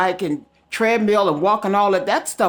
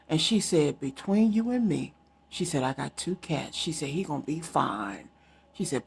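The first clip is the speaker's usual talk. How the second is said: much softer, secretive retelling; hushed confiding; 5 semitones lower, 7 dB quieter than usual. Retold or imitated. County lady quietly reassuring about the cat, hushed confiding. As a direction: lower your voice like sharing a secret.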